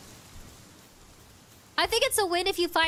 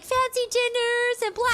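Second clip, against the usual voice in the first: mocking quote; 6 semitones higher than usual. Mocking what everybody says.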